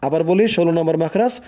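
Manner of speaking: usual speaking voice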